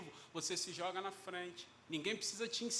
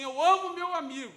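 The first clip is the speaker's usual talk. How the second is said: higher and much louder, proclaiming the phrase; emphatic declaration; 8 semitones higher, 11 dB louder than usual. Shown as a demonstration. Preacher to congregation, demoing the phrase, loud and emphatic. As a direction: belt out "amo" in "Eu amo o meu amigo" as a ringing declaration. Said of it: "Quando você fala assim".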